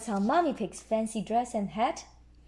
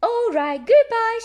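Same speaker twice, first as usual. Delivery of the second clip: high, loud final goodbye; hammed-up phone goodbye; brisk goodbye; 13 semitones higher, 9 dB louder, slower than usual. A narrator's reading. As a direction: end the call loud and brisk. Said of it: "she says, hanging up".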